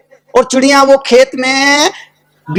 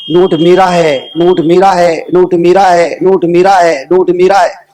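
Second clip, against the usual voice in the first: low, measured repetition with falling ends; retold claim as a low litany; quoted claim; 4 semitones lower than usual. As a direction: intone each claim evenly, pitched down. Note quotes every owner, first take.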